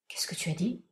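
soft, unvoiced disbelieving whisper; stunned whisper. A narrator's reading. Uncle, gone pale, whispers back to Chiffon. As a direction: breathe it out, shaken.

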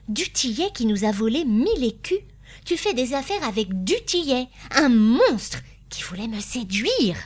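appalled, insistent reproach; indignant accusation. A narrator's reading. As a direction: confront him with mounting outrage. Wife confronts husband over du Tillet.

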